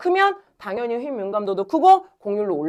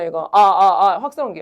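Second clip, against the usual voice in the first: usual speaking voice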